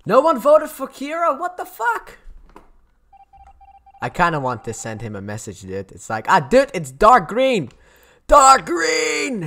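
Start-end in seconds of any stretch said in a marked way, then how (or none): 0.1-2.2 much higher, squeaky incredulous cry; can't believe nobody voted him out; stunned gamer outrage; shocked disbelief. Real, not acted. Vote result shocks him. 6.3-9.5 much higher, loud yelled warning, slow and drawn out; hammed-up frantic yell, DARK GREEN belted; mock scream. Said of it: "I just wanna scream that at him".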